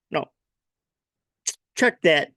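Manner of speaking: ordinary delivery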